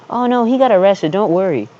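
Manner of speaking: high, light, breezy mom voice; imitating mom's voice. Son imitating his mom telling him it's fine, high and breezy. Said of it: "that's kind of like the voice that she has".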